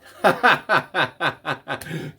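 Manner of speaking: loud, high burst of laughter; real belly laugh at a punchline. Real, not acted. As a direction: crack up in a long run of ha-has. Cracking up at the joke's punchline.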